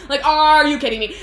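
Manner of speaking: loud, high, stretched "are"; hammed-up yell of disbelief, leaning on "are"; mock outrage. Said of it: "And so he, like, flips out".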